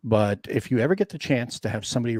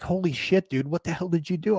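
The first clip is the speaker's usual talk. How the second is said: higher, shocked buddy voice; mock shocked friend; 6 semitones higher than usual. Opponent reacts to the artillery, mock shocked. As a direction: play the stunned opponent who got shelled.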